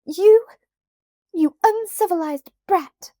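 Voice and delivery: put-on enraged girl screaming at Felicity; furious shriek. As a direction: hurl the insult at her. Annabel glares, storms off.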